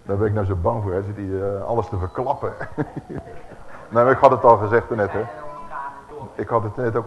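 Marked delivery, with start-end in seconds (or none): 2.6-3.8 soft breathy laugh. Real, not acted. Laughs at own slip.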